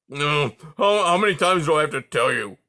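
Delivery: loud and fast, fed up; exasperated. A narrator's reading. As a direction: snap back at him, fed up.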